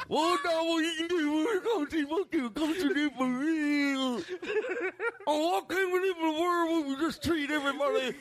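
high-pitched moron working into a rant; moron character. Acted. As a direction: preach peace in a high, dopey voice. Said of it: "but from that guy".

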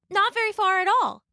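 put-on high, polite little girl voice; a small girl minding her manners. Little Red thanking the wolf, high and polite.

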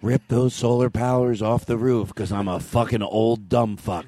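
put-on low, slow, folksy old politician; Ronald Reagan. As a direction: drag it out like a doddering president. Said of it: "Ronald Reagan?".

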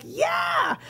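high, excited squeal of a yes; eager yes. Retold or imitated. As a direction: blurt it out thrilled and high. Husband's eager yes, retold.

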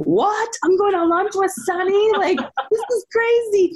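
high, thrilled disbelief with a laugh; starstruck disbelief. Retold or imitated. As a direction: gasp out your disbelief. Disbelief at lunch invite.

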